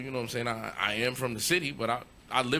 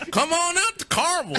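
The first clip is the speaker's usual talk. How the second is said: mock southern accent, higher and louder; 13 semitones higher, 6 dB louder than usual. Host joking about Carmel, southern accent. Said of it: "gave him a southern accent".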